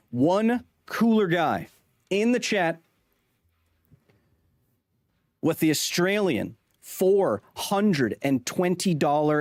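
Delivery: high, drawn-out announcer call; donation shout-out. Real, not acted. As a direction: announce it big and slow, word by word.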